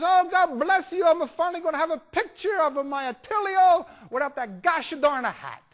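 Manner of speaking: overjoyed Italian grandma, high and loud; Italian grandmother accent. Retold or imitated. Grandmother hugs and thanks photographer, ecstatic Italian accent. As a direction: cry out joyfully in thick Italian-accented English. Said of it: "nice little old Italian lady".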